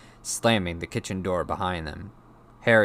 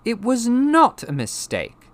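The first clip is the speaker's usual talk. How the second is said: slow, high, loud contradiction; angry retort; 9 dB louder, slower than usual. A narrator's reading. Boy contradicting his uncle, high angry retort. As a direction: hit NOT in angry protest. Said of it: "said Harry angrily".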